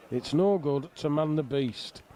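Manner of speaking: ordinary talk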